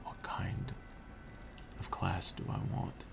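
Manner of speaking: unvoiced whisper so the wife sleeps; quiet at night. Real, not acted. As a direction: whisper softly, trying not to wake anyone. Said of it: "why I'm being so quiet".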